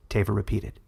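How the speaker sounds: plain reading voice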